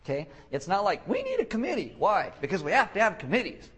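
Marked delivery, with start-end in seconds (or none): 1.1-1.9 high, over-eager mock pitch; squeaky mock insistence; mock committee enthusiast. Acted quote of committee lover. 2.0-3.7 raised, louder mock back-and-forth; mock insistence. Acted committee back-and-forth.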